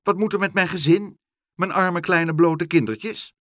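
high, loud and fast pleading; fretting over his children; worried mouse father. Acted. The mouse father frets to Pluk about his children, high and loud. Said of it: "riep de muizenvader".

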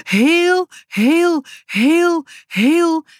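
put-on child's high, aching repetition; repeated heel. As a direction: stretch each heel longingly. Boutje repeats heel, missing father.

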